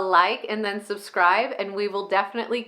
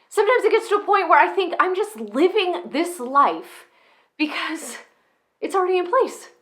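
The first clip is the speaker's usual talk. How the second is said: exasperated comic rant, pitch often jumping high; worked-up comedic monologue, easing off briefly at weary asides; [name], comedic rant; 4 semitones higher than usual. Acted. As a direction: rant like a fed-up, struggling parent with punchy stressed words. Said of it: "this sort of comedic, ranty way".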